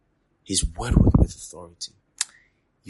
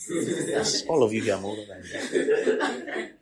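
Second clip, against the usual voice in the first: ordinary talk, nothing marked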